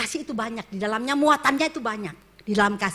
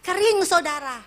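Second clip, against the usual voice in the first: emphatic loud; 6 semitones higher, 4 dB louder, slower than usual. Real, not acted. Preacher stresses spiritual dryness.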